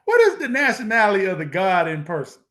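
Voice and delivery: loud, quick challenge; emphatic repeated question. Retold or imitated. Preacher repeats his question to the man he debated, loud and emphatic.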